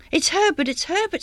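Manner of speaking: high, excited cry without much volume; restrained, high-pitched shriek; understated scream. A narrator's reading. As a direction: cry the name out in wild hope. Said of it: "screamed the woman".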